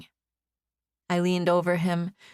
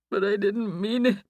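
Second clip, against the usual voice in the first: moaned; 3 semitones higher than usual. A narrator's reading. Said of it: "he moaned".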